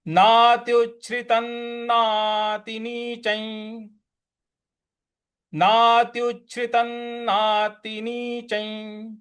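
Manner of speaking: slow chant with drawn नातिनीचं; verse recitation. Shown as a demonstration. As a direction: recite the phrase twice melodically. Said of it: "किस प्रकार से हो जाएगा".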